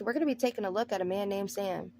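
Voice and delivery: usual speaking voice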